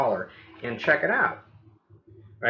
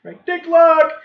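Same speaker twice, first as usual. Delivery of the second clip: much higher, sing-song farewell, last words louder; cheery neighbor goodbye; 9 dB louder, slower than usual. Retold or imitated. Homeowner saying goodbye to the agent, high and cheery. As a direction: wave him off like a friendly neighbor.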